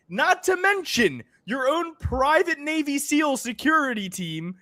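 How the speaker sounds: high, exasperated, disbelieving; incredulous rant. Retold or imitated. Ranting at Joe Rogan, incredulous. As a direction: lay into him like he's lost it. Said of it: "And I'm like".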